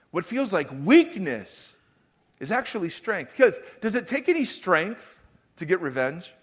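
rising fervent sermon drive; full-force preaching. Acted. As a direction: press the congregation with each question.

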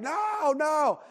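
put-on high, wailing child's protest; imitated tantrum. Son refusing to leave without snacks, mock high wail. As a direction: mimic a five-year-old's drawn-out wail. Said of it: "My five-year-old is screaming".

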